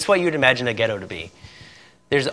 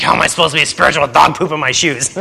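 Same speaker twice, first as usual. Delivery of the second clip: mock angry wife; 5 dB louder than usual. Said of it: "She was so mad".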